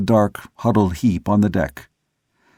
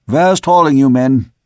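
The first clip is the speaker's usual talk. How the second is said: high, loud command to the men; officer's raised holler; shouted order; 4 semitones higher, 6 dB louder than usual. A narrator's reading. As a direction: shout it across the deck. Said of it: "shouted the second mate".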